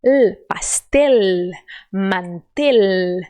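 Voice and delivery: pastel and mantel slowly, the L pressed hard; slow down and lean on the L in pastel, mantel; strong final L. Shown as a demonstration. Teacher drills Spanish final L, slow and pressed. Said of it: "você bota força".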